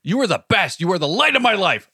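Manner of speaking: high, forceful mock compliments; aggressive praise. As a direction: hammer the sweet words at her.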